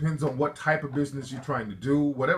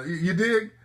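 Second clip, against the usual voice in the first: normal talking voice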